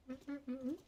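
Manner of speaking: ordinary delivery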